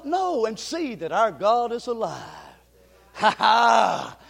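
usual speaking voice

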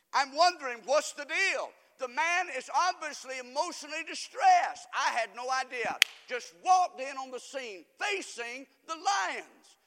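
loud, high, rising sermon climax; preaching at full force. Acted. Preacher shouting his story to the congregation, full force.

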